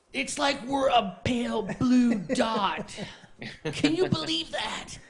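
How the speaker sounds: blown-away hippie wonder, slow; stoner voice. Retold or imitated. Continuing the stoner Sagan bit.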